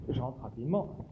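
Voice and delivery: muttered curse, barely audible; cursing under breath. Real, not acted. Curses after failed shot.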